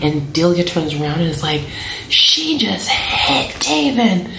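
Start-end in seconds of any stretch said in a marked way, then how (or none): 2.1-4.3 put-on high, drawn-out retelling of a child's alarm; shocked tattling. Delia tells mom her sister was hit, imitated shocked tattling. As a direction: stretch it out high, imitating an upset girl.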